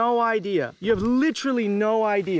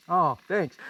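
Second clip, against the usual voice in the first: ordinary talk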